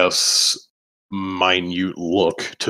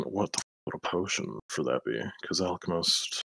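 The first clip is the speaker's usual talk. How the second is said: much quieter, half to yourself; muttering; 13 dB quieter than usual. Real, not acted. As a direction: think it through under your breath. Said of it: "as you're muttering about stuff".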